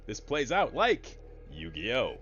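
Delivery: normal talking voice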